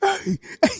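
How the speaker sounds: normal talking voice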